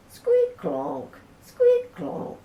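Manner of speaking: high squeaky squeak, then a clonk; playful squeak-clonk sound effect; squeaky bike noise. A narrator's reading. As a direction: voice the wonky pedals, squeak high.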